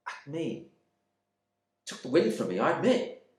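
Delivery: plain reading voice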